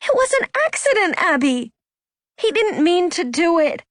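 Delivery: very high, exasperated protest; heated protest. A narrator's reading. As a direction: argue back with pitch shooting up. Anna yelling back at her sister.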